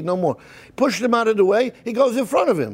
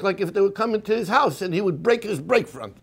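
ordinary delivery

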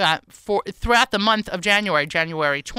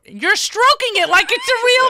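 high teasing voice; 4 dB louder than usual. Real, not acted. Teasing co-host about fake beard.

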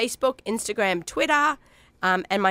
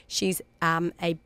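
ordinary delivery

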